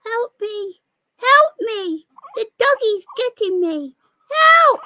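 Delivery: high, loud pretend squeal for help; mock cry for help. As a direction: play the toy being chased by the dog. Voices toy being attacked by dog.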